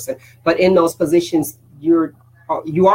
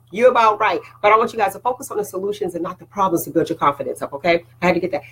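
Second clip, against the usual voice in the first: ordinary talk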